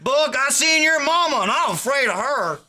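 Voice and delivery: high whooping holler then teasing line; hollering mock hoot; hooting mock excitement. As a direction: whoop it up like a rowdy hunter. Hunters hooting at the note.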